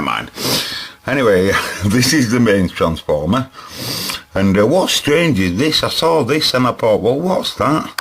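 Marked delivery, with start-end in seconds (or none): none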